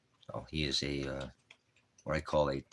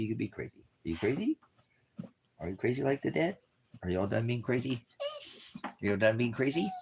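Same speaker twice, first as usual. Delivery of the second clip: higher, sing-song teasing of an excited dog; playful dog talk; 6 semitones higher than usual. Real, not acted. Dad teasing his hyper dog in playful high talk. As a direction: tease the pup gently.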